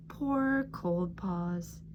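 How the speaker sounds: ordinary delivery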